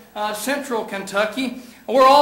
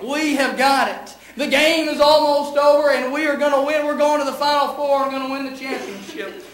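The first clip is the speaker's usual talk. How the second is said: raised, excited sports commentary; play-by-play; 3 semitones higher than usual. Acted. Preacher relives Kentucky versus Duke for the congregation, as excited play-by-play. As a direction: call the last seconds like a game announcer.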